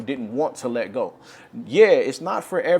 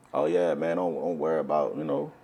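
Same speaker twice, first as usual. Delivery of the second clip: lower, laid-back mock elder voice; imitating an elder; 9 semitones lower, 4 dB quieter than usual. Imitating elders who tell believers not to worry, lower. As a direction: sound like a smooth leader calming his flock.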